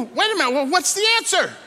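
high, loud and pressing; exasperated demand. Acted. Frustrated businessman pressing the old man for the answer, high and loud. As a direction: demand it, at the end of his rope.

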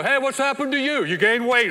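loud, high, in-your-face outburst; blunt Northerner. Acted. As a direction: call it out straight to their face. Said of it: "that's in the North, I'm sorry".

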